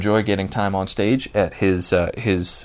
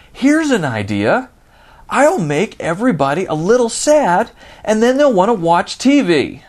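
a mocking, higher, louder dumb-executive voice; mock marketing idiot; 9 semitones higher, 5 dB louder than usual. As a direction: lampoon the clueless marketer pitching his idea. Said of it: "What marketing department idiot thought".

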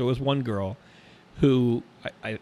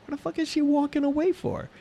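baffled. Retold or imitated. Retold inner confused thought.

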